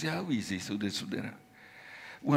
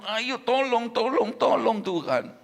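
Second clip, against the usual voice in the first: loud, higher pleading cry for help; crying out to God; 6 semitones higher, 6 dB louder than usual. Retold or imitated. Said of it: "aku berseru kepadamu".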